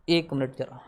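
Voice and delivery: a quick low aside away from the mic; quiet aside. Real, not acted. Teacher breaks off to someone nearby, murmuring softly.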